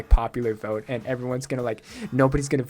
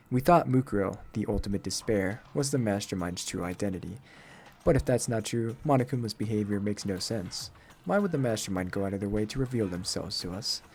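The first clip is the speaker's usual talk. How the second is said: lowered, serious detective-like voice; stern, aloof game character; 5 semitones lower, 4 dB quieter than usual. Acted. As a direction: reason it through coolly, pitched down. Low figure voice reasoning in game.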